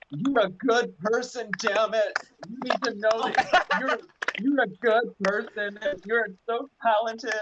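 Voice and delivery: high, slow, insistent encouragement; earnest drunken pep talk. Retold or imitated. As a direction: drag every word out like a drunk friend who means it. Drunk pep talk gripping friend.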